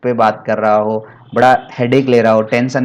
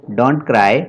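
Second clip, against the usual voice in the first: slow dictation; much slower than usual. Shown as a demonstration. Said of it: "क्या कहते हैं?".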